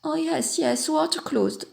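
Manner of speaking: soft, high-pitched English murmur; quiet mimicked Englishman, English accent. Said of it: "je l'entends murmurer".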